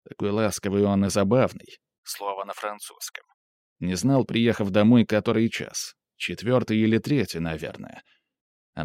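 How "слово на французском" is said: much softer bracketed note; quiet aside. A narrator's reading. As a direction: drop the voice for a reader's note. Reader's aside replacing foreign word. (тихая ремарка в сторону)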